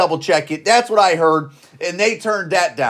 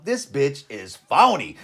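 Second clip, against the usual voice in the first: slow, lowered voice, drawn out; mock blunt verdict; 7 semitones lower, 4 dB quieter, slower than usual. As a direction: imitate unimpressed voters, low and slow. Imagined voters' verdict on her.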